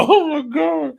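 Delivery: high, loud squealed exclamation; delighted disbelief. Real, not acted. Host amazed her girl won, excited squeal. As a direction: squeal it, still stunned. Still shocked by the winner.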